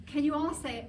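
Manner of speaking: usual speaking voice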